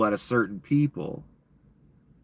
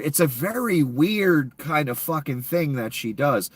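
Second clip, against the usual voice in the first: normal talking voice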